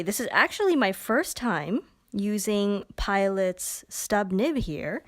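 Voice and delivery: normal talking voice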